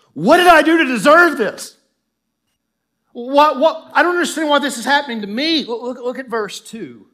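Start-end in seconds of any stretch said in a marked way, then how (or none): 0.1-5.6 very loud, high cry of injustice; preacher's full-force acted outcry; anguished protest. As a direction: shout it at God like a wronged man. Preacher voices David feeling singled out.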